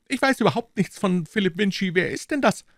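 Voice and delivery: very high put-on character voice; defiant captive. A narrator's reading. Captive Jones denies knowing in character voice. (trotziger Gefangener mit hoher Stimme)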